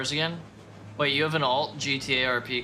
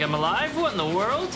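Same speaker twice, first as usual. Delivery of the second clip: mock astonishment; 8 semitones higher, 3 dB louder than usual. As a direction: voice jumping up in disbelief. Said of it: "He's like".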